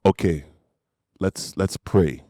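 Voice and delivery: lightly voiced father, taking his time; composed dad. Dad deciding to pray with his son, calmly. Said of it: "And he goes".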